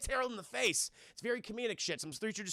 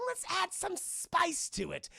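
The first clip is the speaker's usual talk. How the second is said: high, slow mock-villain relish; gleeful villain; 10 semitones higher, slower than usual. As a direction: savor a wicked little experiment aloud. Acts out mad villain at blender.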